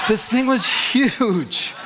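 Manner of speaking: a slow laughing exaggeration. Real, not acted. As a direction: draw it out through laughter. Comic story of oversized microwave.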